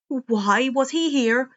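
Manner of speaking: ordinary delivery, nothing marked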